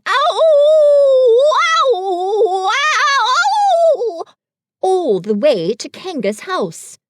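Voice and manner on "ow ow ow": loud high ow after ow; repeated yelps. A narrator's reading. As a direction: yelp at every bounce. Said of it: "And he was saying".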